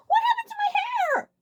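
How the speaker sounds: very high, slow, loud shriek; terrified shout; panicked scream. A narrator's reading. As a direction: scream in horror at the sight. Heart leapt, panic.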